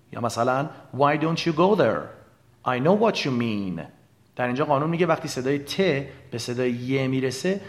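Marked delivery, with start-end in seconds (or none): 1.0-3.8 "don't you" and "what you" said slowly with the t melting into a light ch; slow, clear demonstration of the ch blend; soft ch sound. Shown as a demonstration. (صدای چ خفیف در don't you و what you)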